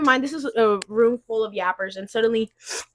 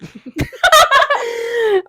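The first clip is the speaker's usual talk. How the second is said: a long, high burst of laughter; 11 semitones higher, 5 dB louder than usual. Real, not acted. Student laughing at her own slip, high. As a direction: laugh at yourself.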